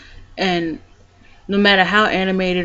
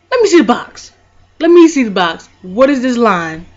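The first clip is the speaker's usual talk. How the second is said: high, loud, insistent demand; urgent; 4 semitones higher, 8 dB louder than usual. Retold or imitated. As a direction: grab for the box, frantic to know. Husband grabbing the test box.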